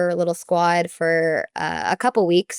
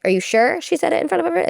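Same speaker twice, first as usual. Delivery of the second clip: higher, fast, skeptical questioning; doubtful question; 10 semitones higher, faster than usual. Retold or imitated. Athletic director doubting the gymnast's account, skeptical. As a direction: question her story quickly, doubting it. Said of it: "he's like".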